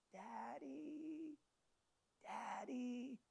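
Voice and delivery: put-on high, very soft child's call; tiny drawn-out cry from far under rubble; faint little voice. Buried boy calls to his father, faint little voice. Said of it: "he hears a faint little voice".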